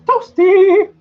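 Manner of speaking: loud, piercing falsetto call; high-pitched catchphrase. Acted. Shouts game catchphrase from chat.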